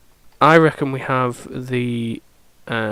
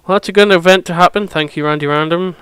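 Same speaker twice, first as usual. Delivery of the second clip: excited; 5 semitones higher, 7 dB louder, faster than usual. Real, not acted. Delight at a lucky event.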